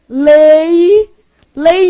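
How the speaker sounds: lei loud and drawn out, i sounded; exaggerated "LEEEI", clear final i; isolated syllable. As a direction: belt out LEI as one long syllable. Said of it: "o I some".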